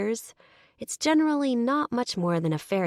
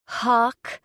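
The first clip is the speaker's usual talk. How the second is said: mocking snort; 6 semitones higher, 5 dB louder than usual. Woman mocks the stranger's name with a snort. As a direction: repeat his name with a scoffing snort, higher and louder. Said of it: "I snort".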